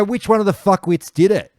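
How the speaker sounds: angry demand. Retold or imitated. Calling after the blokes down the road, high angry shout. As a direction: pick a fight with the whole group. Demanding who threw the fin.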